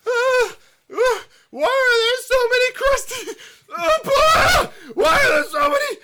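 very high, loud screaming labor voice; woman in labor. Acted. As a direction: shriek through the contractions. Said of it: "I'm gonna go into complete character".

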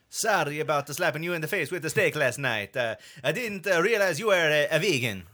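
put-on cartoon Italian accent; bouncy fake Italian, lifting toward the end; Luigi. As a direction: apologise in a mock Italian accent. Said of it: "Yeah, that was Luigi".

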